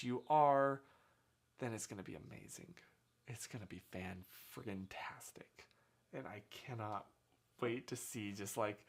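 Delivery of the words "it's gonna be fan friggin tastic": soft, breathy excited whisper. Pretend. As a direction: hush it like a secret thrill. Hushed gushing aside.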